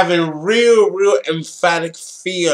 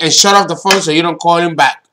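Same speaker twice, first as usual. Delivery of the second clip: ordinary delivery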